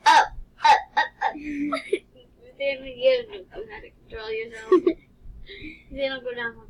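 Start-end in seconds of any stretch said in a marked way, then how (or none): 0.1-5.0 giggling through the words; laughing out loud, high. Real, not acted. Laughing while steering the game.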